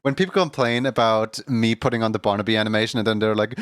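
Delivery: usual speaking voice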